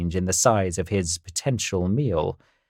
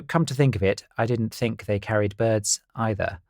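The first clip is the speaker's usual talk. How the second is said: usual speaking voice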